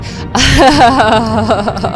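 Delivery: loud, high burst of laughter. Real, not acted. Gamer laughing after swearing at her own phone, loud laugh.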